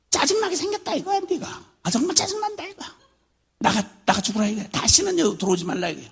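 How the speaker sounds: put-on drunken father driving his wife out, fast and furious; drunk father yelling. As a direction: throw her out of the house in a rage. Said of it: "이렇게 하룻밤에도 몇 차례씩 쫓아내도".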